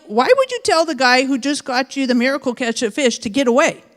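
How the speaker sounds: ordinary delivery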